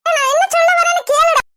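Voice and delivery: high-pitched urgent demand to be heard; exasperated insisting. Acted. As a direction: cut through your son's refusal. Mother insists over son's refusal. (ஆவேசமாக வற்புறுத்துதல்)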